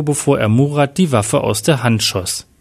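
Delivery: usual speaking voice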